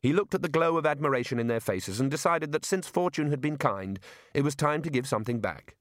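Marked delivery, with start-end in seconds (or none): none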